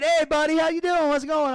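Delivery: breezy and friendly, as if nothing happened; cheery greeting. Retold or imitated. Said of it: "just like you normally would".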